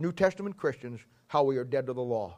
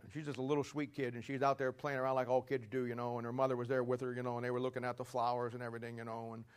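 softer storytelling; 3 semitones lower, 7 dB quieter, faster than usual. Acted. Soft storytelling voice.

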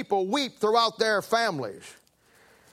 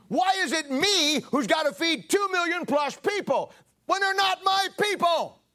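higher, pleading complaint, rising to a cry; exasperated protest; 8 semitones higher than usual. Acted. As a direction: push back at God, worn out and indignant. Moses protesting to God.

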